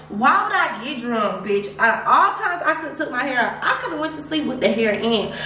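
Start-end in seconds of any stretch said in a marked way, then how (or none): none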